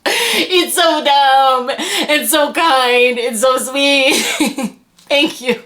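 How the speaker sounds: slow, high, moved; drawn out, deeply touched. Real, not acted. Moved by kindness.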